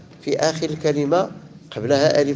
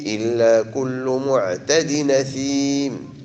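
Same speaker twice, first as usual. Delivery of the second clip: slow chanted recitation of ويل يومئذ للمكذبين; Quran recitation; slower than usual. Shown as a demonstration. Teacher recites for students, slow and measured. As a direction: recite it slowly, as tajwid practice. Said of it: "Quran recitation by teacher".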